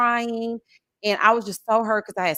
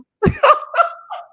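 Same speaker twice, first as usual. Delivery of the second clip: big high cackle; loud high laugh; 7 dB louder than usual. Real, not acted. Laughing at own joke.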